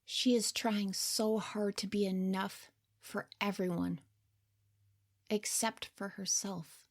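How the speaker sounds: hushed, gentle third-person commentary, half whispered; soft narrator aside. A narrator's reading. Narrator looking back on her younger self, soft and hushed. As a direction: speak softly over the scene like a caring observer.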